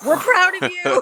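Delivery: high, loud, laughing praise; laughing proud cheer. Pretend. Joining mock award.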